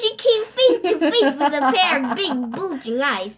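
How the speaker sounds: loud dramatic storytelling; dramatic reveal. A narrator's reading. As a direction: build it up like a big reveal.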